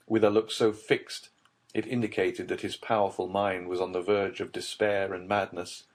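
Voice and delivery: ordinary delivery, nothing marked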